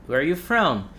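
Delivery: quick "Where are you from?" with "are" melting into "where"; said fast, linked. Shown as a demonstration. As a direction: run "Where are you from?" together in one smooth breath. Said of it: "when you say it fast".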